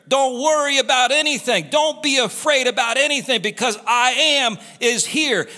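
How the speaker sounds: high, loud sermon climax; a pastor rising to a fervent peak; preaching at full force. Acted. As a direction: preach it out with fire.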